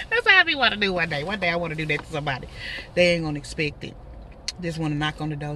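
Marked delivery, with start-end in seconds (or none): none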